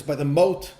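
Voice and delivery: normal talking voice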